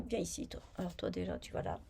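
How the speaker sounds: coaxing it over under your breath; hushed words to a knitting piece; whispered aside. Real, not acted. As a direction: whisper it to the little object. Talking to a stitch marker.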